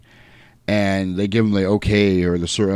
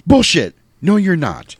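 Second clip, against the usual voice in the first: raised, louder quoted objection; angry retort; 8 semitones higher, 3 dB louder than usual. Retold or imitated. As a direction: cut in with a mock-angry denial. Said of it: "He goes".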